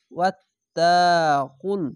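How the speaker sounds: very slow, drawn-out chanted recitation; Quran recitation. Shown as a demonstration. Teacher modelling the recited word for learners, slow and chanted. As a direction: stretch every vowel like a reciter. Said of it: "recites the word as a model".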